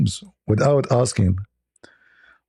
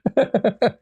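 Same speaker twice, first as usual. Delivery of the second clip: a high, helpless burst of laughter; 14 semitones higher than usual. Real, not acted. Laughing at the stunned man in the elevator, high helpless laugh.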